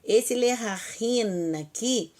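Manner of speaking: ordinary talk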